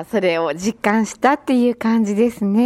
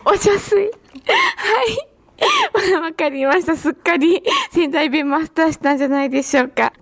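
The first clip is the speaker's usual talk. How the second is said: real amused laughter through the words; higher-pitched, laughing as you talk; 5 semitones higher, faster than usual. Real, not acted. Host tickled by guest's Sendai dialect word, laughing.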